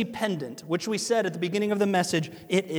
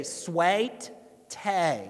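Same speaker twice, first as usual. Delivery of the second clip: slow Southern drawl, sweet tea stretched to swaIt tay; Southern accent drawl. Shown as a demonstration. Pastor mimicking how Southerners say it, slow Southern drawl. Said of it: "they tend to add syllables to words".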